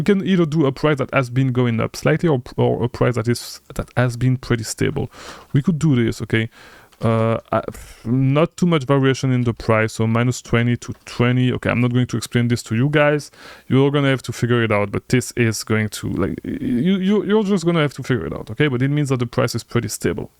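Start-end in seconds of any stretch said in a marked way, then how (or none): none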